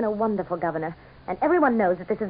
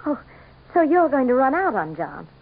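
louder, higher, accusing challenge; 7 semitones higher, 3 dB louder than usual. Acted. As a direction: confront him for deserting John. Accusing campaign manager.

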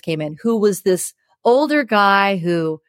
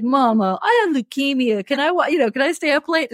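put-on higher, wheedling child plea; kid wheedling; 7 semitones higher than usual. Son asking mom to stay up late, wheedling plea. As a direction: beg sweetly like a cheeky kid. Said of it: "He'd be like".

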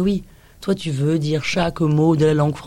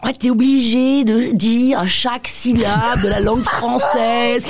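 slow, every syllable pronounced; syllable-by-syllable diction; 4 semitones higher, slower than usual. Acted. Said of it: "Elle joue la comédie et tout". (diction appuyée, chaque syllabe détachée, lentement)

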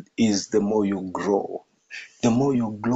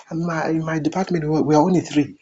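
complaining team leader; 6 semitones higher, faster than usual. Acted. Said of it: "You say".

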